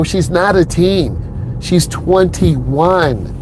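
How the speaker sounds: very slow, each word laid down deliberately; slow emphatic. Real, not acted. Instructor correcting viewers about his student's age, slow and emphatic. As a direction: spell it out slowly so nobody misunderstands.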